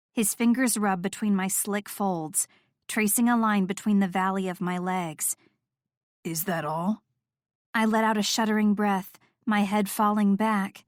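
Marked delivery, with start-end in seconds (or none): 6.3-7.0 low, soft and teasing; a low quiet taunt. Acted. Noah low.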